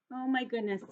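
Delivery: ordinary delivery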